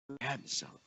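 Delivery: nearly whispered, low and hushed; soft emphasis. Acted. Preacher echoing the father, hushed and tender. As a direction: let it land gently.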